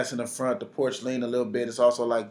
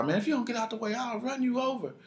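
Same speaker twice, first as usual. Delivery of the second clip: tough threat; 9 semitones higher, 4 dB quieter than usual. Retold or imitated. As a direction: imitate a driver acting tough. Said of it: "the guy got tough".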